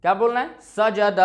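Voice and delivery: सजद crisp and short; quick three-beat सजद; short vowels, read quickly. Shown as a demonstration. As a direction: recite सजद briskly, no vowel held. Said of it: "हमें जल्दी पढ़ना है।".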